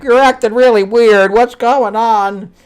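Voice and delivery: high, loud mock questioning; hammed-up bewilderment. Retelling her asking why he acts weird, hammed-up bewilderment. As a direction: push it up high, stretching the question. Acting out her puzzled challenge.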